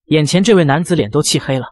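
usual speaking voice

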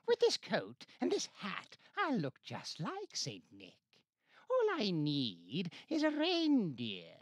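high, loud gleeful villain; gleeful Grinch. A narrator's reading. Grinch delighting in his Santa disguise, gleeful. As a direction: cackle the plan out. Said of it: "chuckled and clucked".

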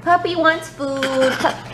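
high, put-on little kid voice; baby voice. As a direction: speak for the baby. Voicing toddler.

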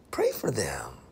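soft whispered instruction. Retold or imitated. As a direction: breathe it out as a gentle whisper. God's voice, gentle instruction.

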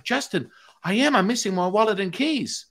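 mock starstruck reply. Hypnotised man tells "Justin" of his loss, mock excited high voice. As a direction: play an excited fan in a high voice.

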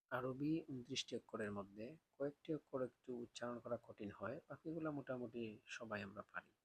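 normal talking voice

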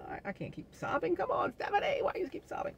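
high, pleading self-talk; scolding herself to stop crying. Retold or imitated. Woman tells herself to stop sobbing, high pleading voice. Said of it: "I was like".

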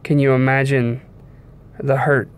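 ordinary delivery

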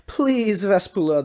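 high, loud desperate plea; man shouting his plea; pleading shout. A narrator's reading. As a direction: cry it out up to the balcony. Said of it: "the man in the bonnet shouted".

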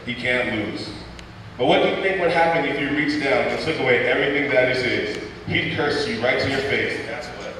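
usual speaking voice

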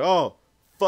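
high, loud cut-off curse; a shouted oh that breaks off mid-swear; retold startled yelp. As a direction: blurt it out in sudden alarm. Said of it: "Then it's like".

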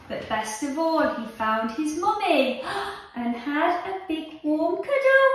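lively read-aloud storytelling voice; reading to children. Acted. Teacher reading a picture book to her class, lively and animated. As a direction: tell it like a bedtime story for little ones.

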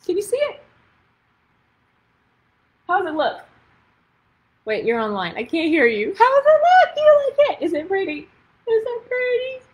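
high, loud, eager questions; giddy author showing her new book; excited. Real, not acted. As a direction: squeal at the live audience.

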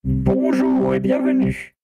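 slow, whispered robot voice. Acted. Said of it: "c'est une voix de robot".

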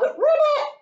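one high, loud ribbit; triumphant croak. Acted. Piggie croaks to prove she is a frog, high and loud. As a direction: pop it out up high.

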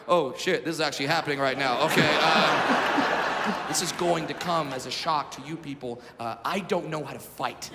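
low, deflated backing down; acted sinking realization; sheepish realization. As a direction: drop from bravado to low-voiced dread. Realizes fight is really happening.

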